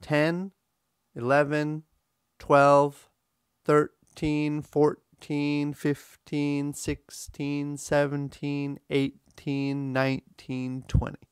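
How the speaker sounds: "thirteen" to "nineteen" with both syllables equally long and stressed; march through "thirteen, fourteen, fifteen" on a level pitch, beat by beat; straight even count. Shown as a demonstration. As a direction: chant the teens evenly, THIR-TEEN, FOUR-TEEN. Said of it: "It sounds like this".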